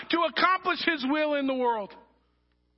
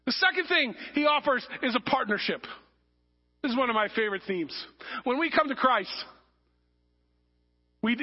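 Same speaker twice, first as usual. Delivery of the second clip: ordinary delivery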